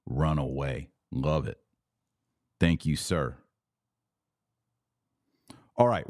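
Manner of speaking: normal talking voice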